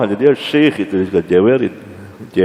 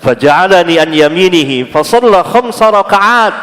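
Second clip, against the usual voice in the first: high, loud chanted Arabic recitation; hadith recitation; 9 semitones higher, 5 dB louder than usual. Real, not acted. Preacher reciting the hadith text to the congregation, chanted.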